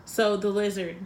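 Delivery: ordinary talk, nothing marked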